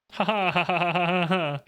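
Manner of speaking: high mocking villain laugh ha ha; Mandark laugh. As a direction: cackle like Mandark. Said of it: "That's Mandark".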